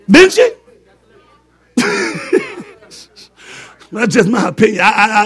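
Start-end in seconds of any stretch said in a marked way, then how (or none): 1.8-2.6 high giggle; laugh. Real, not acted. Preacher laughing after teasing the women. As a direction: chuckle at the congregation.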